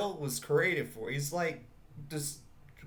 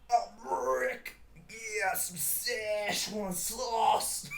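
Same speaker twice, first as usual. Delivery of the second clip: high, belched, very drawn-out words; drunken cartoon impression; 8 semitones higher, much slower than usual. Imagining a reply to McDonald's staff in drunken Rick voice. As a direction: stretch the order out like a burping drunk.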